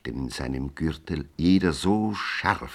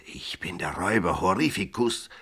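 whispered with a slushy lisp; 4 semitones higher, faster than usual. Acted. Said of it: "lispelte er".

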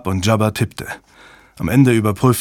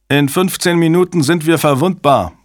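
higher, louder alarmed shout; 7 semitones higher, 4 dB louder than usual. A narrator's reading. Technician warns the room of the deadline, shouting. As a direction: yell the warning across the room. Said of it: "schrie ein Techniker".

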